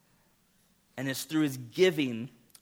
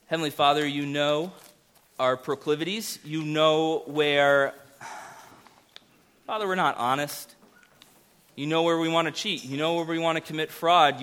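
earnest prayer, steady and slightly louder; pastoral prayer; 4 semitones higher, 4 dB louder than usual. Acted. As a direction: pray humbly for the congregation. Leads a prayer.

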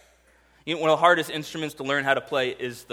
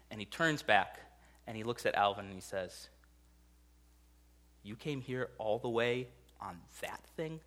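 hushed storytelling; 6 semitones lower, 11 dB quieter than usual. A narrator's reading. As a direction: drop to a soft, hushed telling. Retelling the tearful movie climax.

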